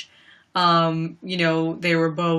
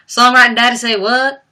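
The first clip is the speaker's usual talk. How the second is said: put-on [name]'s Southern accent; 6 semitones higher, 8 dB louder than usual. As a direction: do her twangy Southern line. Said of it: "Her accent".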